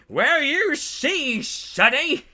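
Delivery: hammed-up old-timer lecture; old man. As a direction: croak it out like an ancient wrestler. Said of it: "He's, like, eight thousand years old".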